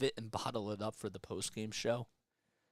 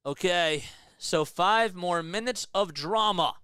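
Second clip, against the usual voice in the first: live play-by-play; 5 semitones higher, 5 dB louder than usual. Acted. Resumes overtime play-by-play.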